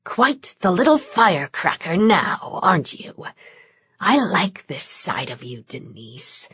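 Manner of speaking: leer and linger on attractive; sly demon purr. A narrator's reading. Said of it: "The way he emphasized that last word".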